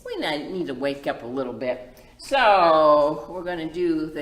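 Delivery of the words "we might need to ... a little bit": ordinary talk, nothing marked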